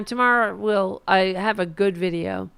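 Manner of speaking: normal talking voice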